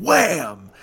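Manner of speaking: loud drawn-out wham; sudden big crash noise, stretched; imitated crash. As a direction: boom it out like the impact.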